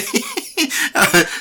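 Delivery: a sudden high burst of laughter. Real, not acted. Host laughing at his own medical advice, high laugh. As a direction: laugh at yourself, high and bright.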